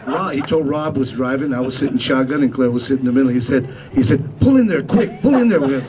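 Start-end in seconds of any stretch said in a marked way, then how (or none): 4.4-5.6 urgent excited plea. Retold or imitated. Friend urging the driver to stop.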